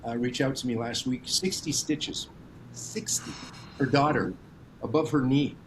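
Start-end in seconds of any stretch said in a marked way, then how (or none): 1.2-3.3 sixty stitches said slowly, then Sixty breathed unvoiced; slow emphatic stress. Real, not acted. Telling the hosts about a child's injury, slow hushed emphasis.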